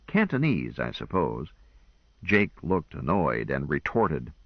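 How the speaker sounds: ordinary delivery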